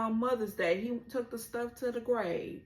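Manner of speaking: usual speaking voice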